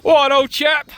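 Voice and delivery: put-on high, loud posh "What, old chap?"; hammed-up English gent; clipped wartime posh. Jokey posh greeting.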